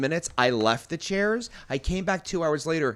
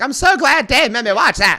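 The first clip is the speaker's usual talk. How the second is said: high, loud mock-gushing quote; imitated woman; 9 semitones higher, 9 dB louder than usual. Quoting her after the forced movie, mock gushing. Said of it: "and she's like".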